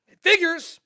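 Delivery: loud high bitter yell; angry outburst. Acted. After the wrong autograph, loud bitter yell. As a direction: explode with bitter disgust.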